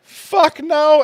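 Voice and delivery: a high, sharp "fuck no"; retold outright no; emphatic refusal. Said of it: "I go".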